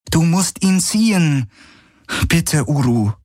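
high-pitched imploring; earnest pleading. A narrator's reading. Kirai begs her mount to pull, pleading. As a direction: implore the animal to help.

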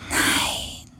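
soft whispered no; geflüstert. A narrator's reading. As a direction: whisper it gently. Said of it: "flüsterte der Geist". (leises Flüstern)

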